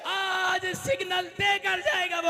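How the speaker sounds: loud, slow, high shouted sermon; full-force preaching. Acted. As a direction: thunder it out over the congregation. (पूरे जोश में चिल्लाकर प्रवचन)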